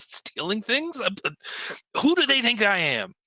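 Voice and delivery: flustered, quick-talking official with pitch climbing; harried townmaster. Acted. Townmaster venting to adventurers about ruffians, harried and indignant.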